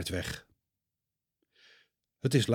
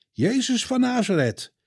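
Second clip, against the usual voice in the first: stern; 12 semitones higher, 3 dB louder than usual. A narrator's reading. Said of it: "zegt een van hen streng".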